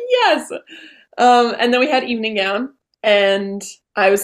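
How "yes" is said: very high squealed yes; a thrilled little squeal of yes; celebrating mom's praise; triumphant yes. Retold or imitated. Her triumphant reply.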